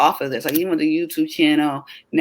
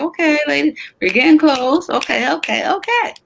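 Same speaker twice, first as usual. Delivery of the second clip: higher, bright rallying call, last okay jumping way up; excited pep talk; 10 semitones higher than usual. Retold or imitated. Host hyping the ladies as the event nears, much higher. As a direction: rev up the ladies as the day gets close. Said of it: "and I was like".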